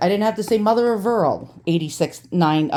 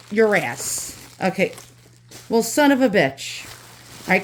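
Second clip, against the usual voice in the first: ordinary talk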